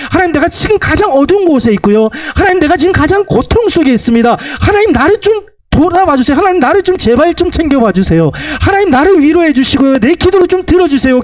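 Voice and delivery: high-pitched, insistent pleading; crying out in prayer. Acted. Believer begging God to look at their suffering, acted crying out. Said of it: "하나님께 우리가 부르짖는데".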